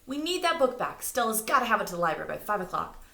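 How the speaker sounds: high, loud and fast; urgent plea. A narrator's reading. Morty pleading with the mother, urgent and fast. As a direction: hurry them, it's urgent.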